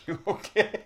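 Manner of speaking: high, loud startled question; surprised. Real, not acted. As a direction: ask it in sudden surprise. High surprised check on friend.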